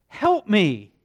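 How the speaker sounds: loud, high cry of help me; a father crying help me to the Lord; loud plea. Acted. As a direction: plead help me out loud, desperate. Father's plea, then repeated hushed.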